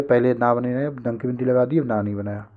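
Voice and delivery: normal talking voice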